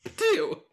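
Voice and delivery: loud high spit sound; spitting. Acted. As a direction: spit it out with force. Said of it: "I spit on his grave".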